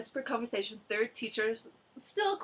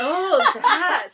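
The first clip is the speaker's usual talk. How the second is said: laughing mock; 6 semitones higher, 11 dB louder than usual. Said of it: "I was like".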